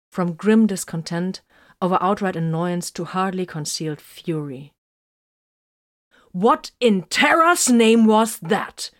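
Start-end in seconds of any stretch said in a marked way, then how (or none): 6.3-8.9 seething, accusing outburst; furious demand. A narrator's reading. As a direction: lay into him for taking the bullet. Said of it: "Vox demanded".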